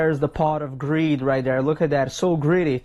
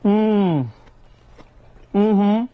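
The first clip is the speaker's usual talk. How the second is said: loud satisfied hum; 5 dB louder than usual. Real, not acted. Tasting the sandwich.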